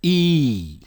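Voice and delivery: "E" held long, high and falling; stressed E. Shown as a demonstration. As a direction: draw out "E" as a stressed syllable. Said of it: "They're stressing the E".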